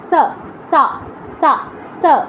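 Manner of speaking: সা with the s of English "sun"; dental sa. Shown as a demonstration. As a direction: say সা separately with hissing s, never sh. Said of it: "অ্যাসের উচ্চারণটা কী করছেন".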